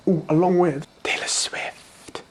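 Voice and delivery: slow breathy whisper, like a secret; whispered aside. Pretend. Whispered aside about [name].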